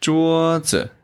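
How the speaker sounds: 桌 high and level, 子 dropped; neutral tone demo. Shown as a demonstration.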